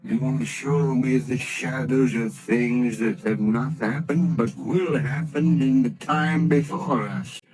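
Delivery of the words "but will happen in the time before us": normal talking voice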